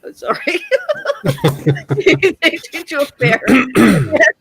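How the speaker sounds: real giggles breaking through, high-pitched; helpless laughter spilling into the words; laughing while talking. Real, not acted. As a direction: crack up mid-story and struggle on through the laughter. Breaks up laughing while telling story.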